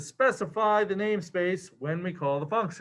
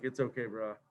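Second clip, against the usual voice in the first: much softer, lower, soothing bro; tender reassurance; 7 semitones lower, 10 dB quieter than usual. Retold or imitated. Compiler forgiving the coder, soft and low. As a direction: comfort a buddy gently. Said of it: "He's like".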